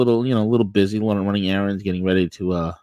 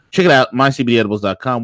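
fast ad plug; 2 semitones higher, 4 dB louder, faster than usual. Acted. Sponsor plug read.

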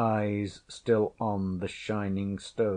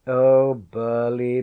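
louder, slow teasing laugh on the name; 4 semitones higher, 9 dB louder than usual. A narrator's reading. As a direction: laugh fondly at the boy. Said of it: "she laughed".

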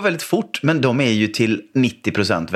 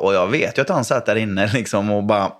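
ordinary talk

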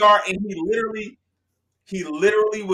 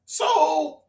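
excited reasoning; 7 semitones higher than usual. Retold or imitated. Retold excited inner reasoning.

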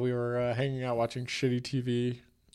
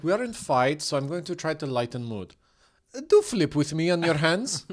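higher mock Ukrainian accent; broken English in a raised, comic Ukrainian accent; Natalie; 4 semitones higher than usual. Bride trying to lighten the mood, Ukrainian accent. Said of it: "She talks like this".

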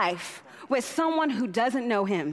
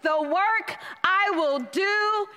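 rising scripture reading; 5 semitones higher, slower than usual. Acted. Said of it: "what it says in the Message".

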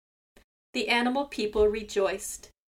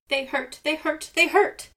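high, rising complaint of pain; whimpering in pain; 6 semitones higher, 6 dB louder than usual. A narrator's reading. Seagull begging Raven for help, high pained whine. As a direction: whine like a hurt old bird. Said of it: "cried Seagull".

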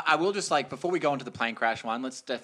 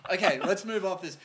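ordinary talk

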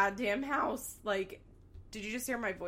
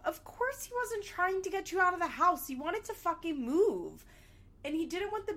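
indignant rant; 8 semitones higher than usual. Real, not acted. Ranting at Janelle.

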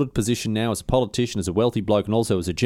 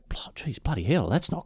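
breathless whispered curse; whispered dismay; 3 semitones higher, 7 dB quieter than usual. Retold or imitated. Ambassadors shocked by Alcibiades' warning, whispered curse.

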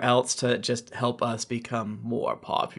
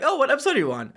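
much higher, bright fan question; imitating a fan; 11 semitones higher, much faster than usual. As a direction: mimic an eager fan. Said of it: "they're like".

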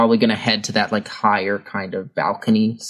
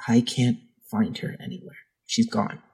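quiet; 6 dB quieter than usual. Acted. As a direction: say it under your breath. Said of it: "he says".

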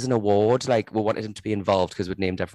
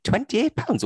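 high-pitched retold disbelief; incredulous retort; 14 semitones higher, slower than usual. Retelling incredulous reaction to friend's spending.

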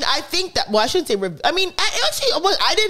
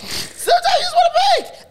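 laughing shout. Retold or imitated.

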